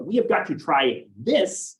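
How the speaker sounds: "this" stretched out and punched at the end; emphasis landing on the final word "this"; stress on THIS. Shown as a demonstration. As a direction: build slowly to a strong "this". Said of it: "it'd be highlighting something that is available".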